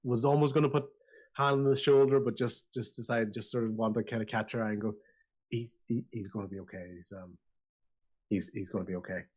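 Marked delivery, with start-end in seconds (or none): 5.5-9.2 softly reassuring, hesitant at first; quiet reassurance. Acted. Consoling someone worried about the injured man, quiet reassurance. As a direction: drop to a soft, halting assurance.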